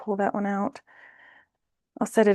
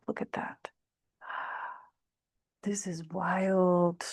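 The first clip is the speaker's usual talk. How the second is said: whispered amazement; 8 dB quieter than usual. Real, not acted. Reacting to the cards.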